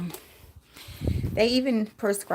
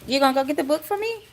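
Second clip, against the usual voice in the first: pet talk; 6 semitones higher, 5 dB louder, much faster than usual. Real, not acted. Still talking to pet.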